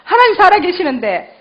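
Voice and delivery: re-enacted conviction, ending high and loud; renewed conviction. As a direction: recall a sudden surge of strength, pitch lifted. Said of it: "갑자기 없던 힘이 생기는데".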